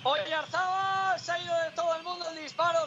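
high, stretched call of the name; rising live shot call; excited commentary. Acted.

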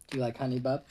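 ordinary delivery

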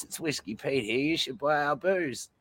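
lightly put-on Aussie pitchman; mock advert from the booze's bogan spokesman. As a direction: sell the whiskey like a larrikin ad. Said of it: "ultimate Aussie fucking bogan spokesperson".

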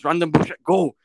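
loud shout; battle shout. Retold or imitated. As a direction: yell it as a rallying cry. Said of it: "he turns around and he goes".

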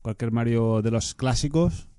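ordinary talk, nothing marked

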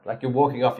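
normal talking voice

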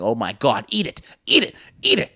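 hammed-up excited chant; mock urging. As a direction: egg him on, building with each repeat. Urging film character to eat poison.

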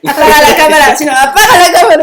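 half-laughing mock yell; mock shout. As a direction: bark a pretend order to kill the camera. Imagined jealous rampage.

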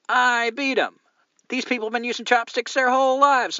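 high, loud gleeful gloating; triumphant boast. Real, not acted. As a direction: rub it in, loud and high-pitched. Boasting about beating chopstick contest.

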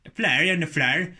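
loud mock Northern Irish; Northern Irish accent. Shown as a demonstration. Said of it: "you got the Northern Irish".

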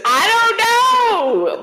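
high, drawn-out exclamation; real high-pitched protest; loud protest. Real, not acted. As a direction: cry out in exasperated defense, stretching it. Protests while co-host laughs at her.

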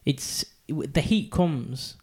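ordinary talk

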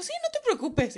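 high-pitched mock boy; retold boy's reassurance. Boy reassuring her about the phone, mock high voice.

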